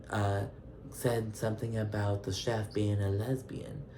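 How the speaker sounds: a mock courtroom cross-examination, hushed and deliberate; lawyer voice. Phaedra grilling Brandi at the dinner table, in her quiet lawyer voice. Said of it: "Phaedra brings out her lawyer voice".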